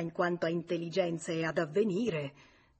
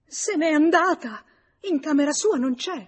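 high, loud panic; alarmed, frantic exclamation; distraught outcry; 9 semitones higher, 10 dB louder than usual. A narrator's reading. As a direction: cry out the dreadful news. Mother discovers daughter has fled.

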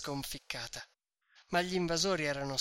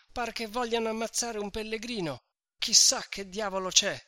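high, urgent mock shout; breathless shout; 6 semitones higher than usual. A narrator's reading. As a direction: gasp out the alarm after running. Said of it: "gridò".

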